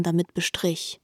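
ordinary talk

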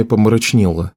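usual speaking voice

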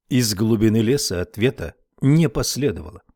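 ordinary delivery, nothing marked

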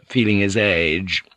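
plain reading voice

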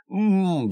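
high closed-mouth groan; wordless muffled "mmm"; muffled moan. A narrator's reading. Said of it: "замычал Джейсон".

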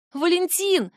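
high shout of a name. A narrator's reading. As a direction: call out in panic. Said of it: "вновь кричу я".

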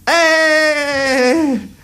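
high, ringing countdown calls, each number falling away; New Year countdown. Acted. New Year countdown shouted on stage.

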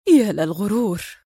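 understated disgust; resentful mumble. A narrator's reading. Woman fed up with his arrogance, resentful. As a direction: grumble it resentfully. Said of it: "وهي تغمغم في سخط".

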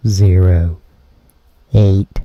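usual speaking voice

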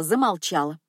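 plain reading voice

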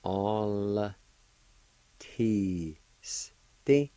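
"All teasty" drawn out, syllable by syllable; sound by sound. Shown as a demonstration. Cherokee teacher showing learners a word, "All teasty" in slow pieces. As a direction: stretch "All teasty" with long gaps between the sounds. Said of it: "pronounce the L sound with the A like this".

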